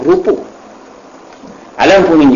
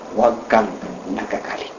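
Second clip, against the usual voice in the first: much softer, dropping almost to a breath; soft repeated advice; 6 semitones lower, 11 dB quieter than usual. Acted. Preacher quietly repeating the Prophet's advice to the congregation. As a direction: let the advice sink in gently.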